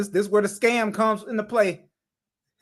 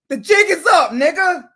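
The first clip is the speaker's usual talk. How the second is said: loud, high, furious outburst; angry shout; 8 semitones higher, 8 dB louder than usual. Real, not acted. Commentator confronting the scammer over frozen funds, furious shout. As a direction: let the anger explode.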